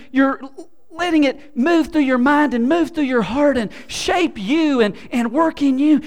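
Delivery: loud, high, fervent preaching; full-force preaching. Real, not acted. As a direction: proclaim it to the whole room.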